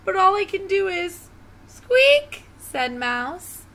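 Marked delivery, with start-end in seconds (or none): 0.1-1.3 high, upset little lion; a touch of snuffly self-pity in a small high voice; snuffling. Acted. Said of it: "he snuffled". 1.7-2.4 very high, loud squeak; piercing mousy squeak; mouse squeak. Acted.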